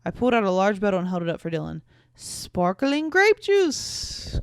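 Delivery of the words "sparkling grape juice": high, loud, very drawn-out announcement; grand reveal. Acted. Woman presenting a bottle to boyfriend, grandly drawn out. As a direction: stretch it out like a prize reveal.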